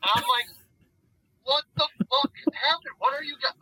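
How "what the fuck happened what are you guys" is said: high, stunned outburst; shocked. Retold or imitated. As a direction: react high to the mess. Retold waking to mess.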